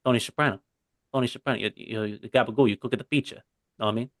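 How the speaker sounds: lightly slurred Jersey mobster voice; Tony Soprano. Acted. Showing off his accent to co-hosts, mobster impression. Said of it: "my Tony Soprano accent is really good".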